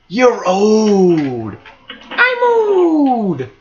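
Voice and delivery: high, stretched-out put-on cry; mock taunting voice. Gamer teasing himself about his age, high mock-taunting voice. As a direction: draw it out high in a silly voice.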